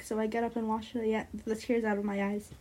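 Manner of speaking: ordinary delivery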